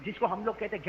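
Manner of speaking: usual speaking voice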